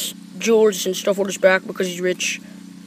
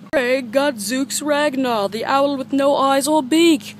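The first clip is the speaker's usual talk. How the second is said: higher, slow cast roll-call; movie credits announcer; 5 semitones higher, 3 dB louder, slower than usual. Acted. Kid reading the cast list, announcer voice. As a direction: announce each name like closing credits.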